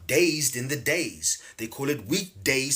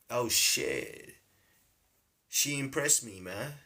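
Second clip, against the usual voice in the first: lightly put-on American accent, pitched down and soft; 3 dB quieter, slower than usual. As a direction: imitate an impressed American man. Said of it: "always do an American accent".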